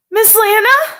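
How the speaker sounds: frightened call into wrecked room; uncertain, worried calling; small scared call. Acted. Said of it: "My voice sounded small and distant".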